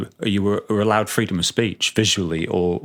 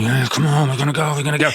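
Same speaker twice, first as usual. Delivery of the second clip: imitated excited crowd, higher pitched; eager crowd; 6 semitones higher, faster than usual. Bandmates urging each other on before the carnival, mock excitement. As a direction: act out an impatient band itching to start.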